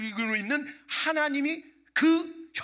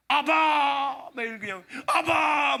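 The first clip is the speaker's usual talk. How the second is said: mock tearful cry; 5 semitones higher, 6 dB louder, much slower than usual. Mocking how believers only cry Abba.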